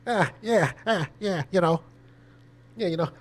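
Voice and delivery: Boston-style "yeah, you know" pitched high; Boston accent. Retold or imitated. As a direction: put on a lightly voiced Boston accent. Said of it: "New York, Philly, Boston close".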